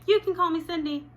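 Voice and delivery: put-on nervous girl blurting to the teacher; eager interjection. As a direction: jump in before she mangles the name.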